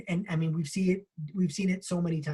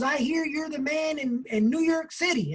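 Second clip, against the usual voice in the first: put-on Texas accent; 7 semitones higher than usual. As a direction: stretch it out like a Texas businessman. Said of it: "with a strong Texas accent".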